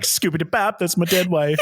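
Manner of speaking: mock widower bit with a goofy scat opening; old man. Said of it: "he's old, old man".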